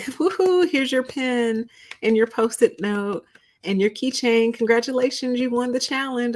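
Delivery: high, sing-song fake cheer over cheap prizes; mock cheerful presenter. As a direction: ham up a peppy host handing out junk. Mocking the cheesy prize giver.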